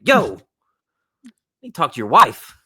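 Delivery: loud, high call for the wife; fed up, half laughing; mock demand.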